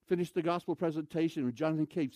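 normal talking voice

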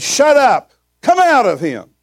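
high, loud exorcist's command; sudden forceful shout of rebuke. Retold or imitated. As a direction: order the demon out at full voice. Reenacts commanding an evil spirit out.

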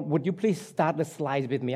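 usual speaking voice

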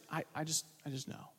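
barely audible, voice sinking away; hushed grieving reply. Retold or imitated. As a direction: let the mother's answer fade almost to nothing. Grieving mother voiced in retold story.

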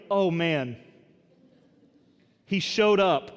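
dismayed pretend quote. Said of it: "It's like".

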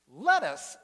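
loud, baffled repeat; Cowboy repeating the punchline in loud disbelief; incredulous cowboy. Acted. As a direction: throw the word back in disbelief.

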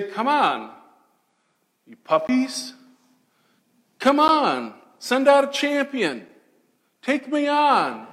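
high, loud taunting call; taunting giant. Retold or imitated. Goliath challenging Israel's army to fight, taunting shout. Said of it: "he would taunt Israel every day".

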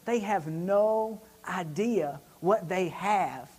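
very slow, spaced-out words; emphatic disbelief. Retold or imitated. Passenger on owners of such trucks, slow emphatic disbelief. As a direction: draw out NO IDEA and let it sink in. Quotes his own repeated pronouncement.

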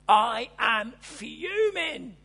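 a hint of a fuming synagogue leader; mock fuming. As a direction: stretch each word as if seething. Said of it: "You've heard that phrase".

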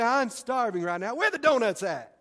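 lightly voiced craving for donuts; mock hungry craving. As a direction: play the starving shopper. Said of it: "No, you say".

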